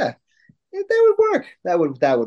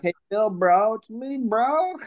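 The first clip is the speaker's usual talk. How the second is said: mock chummy plea; 9 semitones higher, slower than usual. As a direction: voice sliding high and drawn out. Imagined call to a wrestler.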